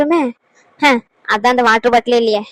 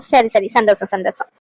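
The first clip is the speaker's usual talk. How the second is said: laughing; 5 semitones lower than usual. Acted. Laughing with relief.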